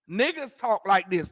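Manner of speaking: ordinary delivery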